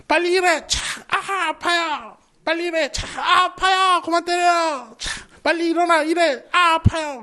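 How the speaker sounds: high, shouted orders and cries of pain; overseer and slave. Acted. Taskmaster whipping Israelites, shouting and slaves crying out.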